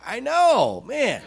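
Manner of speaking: high, amused heartfelt agreement; emphatic agreement. Retold or imitated. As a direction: agree emphatically with pitch leaping up. Comically agreeing with parents' complaint.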